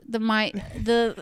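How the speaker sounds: ordinary delivery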